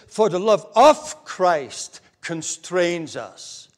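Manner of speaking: slow quote, leaning hard on "of"; stress on "of". Shown as a demonstration. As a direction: make "of" stand out, long and strong. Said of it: "Do you hear the difference?".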